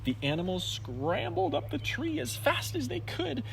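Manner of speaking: ordinary delivery, nothing marked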